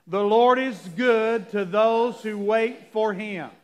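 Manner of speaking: slow, measured scripture reading; unison reading. Real, not acted. Pastor reading scripture with the church, slow and even. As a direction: read together, slowly and carefully. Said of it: "Let's read it together".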